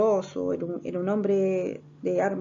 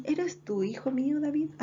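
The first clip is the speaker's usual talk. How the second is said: playing innocent; 7 semitones higher than usual. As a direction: feign surprised fondness, hamming it up. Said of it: "haciéndose el loco".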